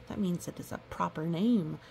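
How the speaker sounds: ordinary delivery, nothing marked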